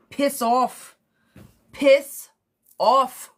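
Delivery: mock British "Piss off", loud and punchy; a bad put-on British accent telling him to piss off; horrible British accent. Telling the ex to go away in a bad British accent. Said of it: "with a horrible British accent".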